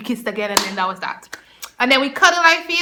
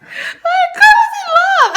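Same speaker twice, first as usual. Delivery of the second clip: very high, loud wailing gush; hammed-up lovestruck wail; lovestruck squeal; 7 dB louder than usual. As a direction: squeal it out, swooning over a crush. Gushing about being in love.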